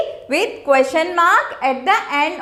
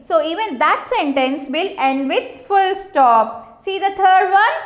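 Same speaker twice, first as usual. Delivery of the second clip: usual speaking voice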